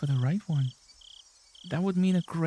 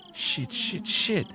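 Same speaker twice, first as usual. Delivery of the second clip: panicked cursing; 9 semitones higher, slower than usual. Acted. Key feels limp, panic rising.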